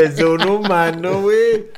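amazed, laughing repeat; incredulous echo. Real, not acted. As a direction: stretch it out in shock.

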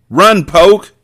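loud, high warning yell; urgent shout. Acted. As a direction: shout it to save him. Warning friend of attacking vampire.

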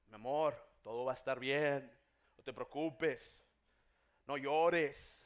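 low, reassuring voice; comforting husband. Acted. Husband to his crying wife in the car, low and reassuring. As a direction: soothe a crying wife in a deep voice.

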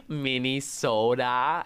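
slow drawn-out Minnesotan Minnesota, Minne-soh-dah; Minnesotan accent. Retold or imitated. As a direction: stretch "Minnesota" Minnesotan style with a loud sing-song. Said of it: "I'd have the little Oda".

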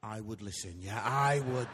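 very low, level voice of God; God giving advice. Retold or imitated. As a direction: play God wryly siding with an angry wife. God's dry answer after wife's outburst.

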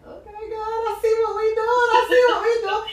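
high, laughing, delighted; smiling aside to God; knowing laugh. Retold or imitated. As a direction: let it spill out with a grin. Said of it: "I just smiled like".

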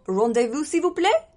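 hammed-up French accent, high and loud. Shown as a demonstration. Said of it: "That's my French accent".

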